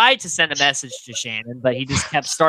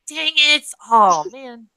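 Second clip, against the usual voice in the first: put-on high, sulky childish groan; whiny kid; 6 semitones higher, slower than usual. Will told he can't touch the car, childish whine. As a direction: pout like a kid who lost a toy. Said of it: "Will's like a kid".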